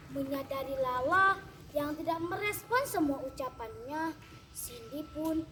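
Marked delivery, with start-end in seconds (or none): none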